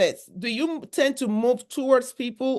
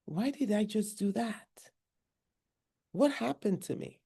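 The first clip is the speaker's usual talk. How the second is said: regretful murmur; 10 dB quieter than usual. Retold or imitated. Woman regrets outburst, alone.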